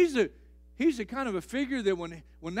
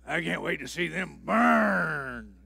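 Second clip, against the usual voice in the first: mock God voice; 5 semitones lower than usual. Preacher mocks the idea of a hateful God, lower sneering voice. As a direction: lower mocking imitation of a spiteful God. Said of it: "God's not up there going".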